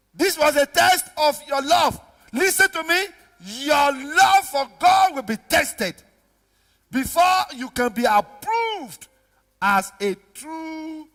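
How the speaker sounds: loud, high, drawn-out proclamation; full-force preaching. Real, not acted. Preacher warning believers that love is tested, slow full-force preaching. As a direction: stretch the key words slowly and loudly.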